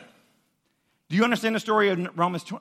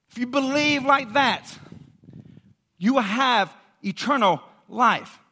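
raised, urgent sermon voice; emphatic preaching; 4 semitones higher, 5 dB louder, slower than usual. Real, not acted. Preacher urging listeners to believe like that, emphatic preaching. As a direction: preach it with heavy, deliberate emphasis.

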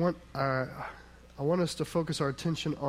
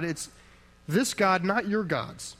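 ordinary delivery

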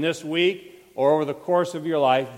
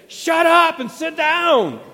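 slow, much higher and louder rebuke; fierce rebuke; 10 semitones higher, 6 dB louder, slower than usual. Retold or imitated. Jesus rebuking Peter, hard and slow. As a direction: bark it down at him, slowly. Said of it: "So here's what he said".

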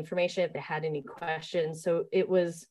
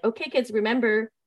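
put-on raised, higher voice calling to kids; teacher calling out; 5 semitones higher, 5 dB louder than usual. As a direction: project it to a field of archers.

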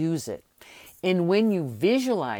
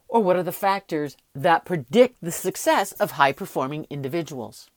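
ordinary delivery

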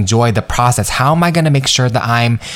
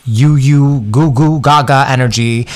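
mock baby talk. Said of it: "I'm making up words as we speak".

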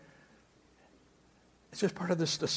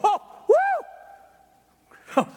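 mock startled; 7 dB louder than usual. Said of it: "And we go".

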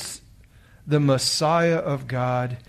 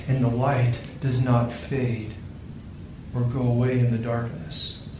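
softer, tender reassuring voice; gentle; 5 dB quieter than usual. A narrator's reading. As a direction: comfort a frightened child softly. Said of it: "The Christ candle spoke gently".

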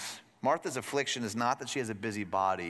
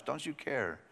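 quiet incredulous; 6 dB quieter, slower than usual. Shown as a demonstration. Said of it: "She asks the God of the universe".

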